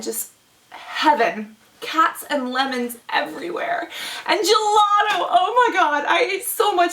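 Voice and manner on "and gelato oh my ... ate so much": gelato rapture. Real, not acted.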